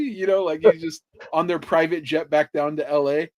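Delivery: ordinary delivery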